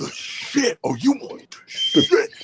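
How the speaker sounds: high, drawn-out spooky quote; slow, high-pitched horror movie impression; favorite movie line. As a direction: draw it out, swooping way up like a creepy film character. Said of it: "Tales from the Hoodie".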